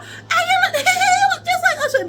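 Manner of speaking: high squealy imitation; mock fangirl gush. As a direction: gush like the swooning girls. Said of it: "they are".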